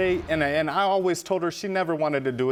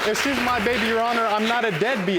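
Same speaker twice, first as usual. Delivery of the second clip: pleading; 6 semitones higher than usual. Real, not acted. Defendant pleads before the judge.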